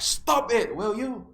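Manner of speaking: high, loud imitated yell; angry outburst. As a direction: explode at someone who startled you. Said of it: "he lost it".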